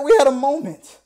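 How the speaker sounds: high, loud self-justifying; mock defensive protest. Deluded fan justifying himself to friends, loud protest.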